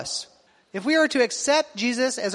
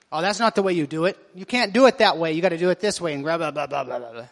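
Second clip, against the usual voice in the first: lower, bossy know-it-all voice fading into mumbled blah; mock nagging critic; 6 semitones lower, faster than usual. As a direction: pastor mimics a fussy critic, lower and fading. Pastor mimics a nitpicking critic.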